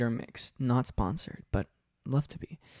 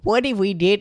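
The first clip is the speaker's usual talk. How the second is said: higher, louder attempt at a kid voice; girlish voice; 7 semitones higher, 6 dB louder than usual. Shown as a demonstration. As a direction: pitch it up, almost like a girl. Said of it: "that still sounds like a girl".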